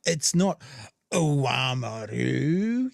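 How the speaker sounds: slow, drawn-out sneer on Oamaru; mock the caller's scornful tone; a light imitation of a bigoted caller stretching out Oamaru; disdainful correction. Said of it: "you can really hear the angle change".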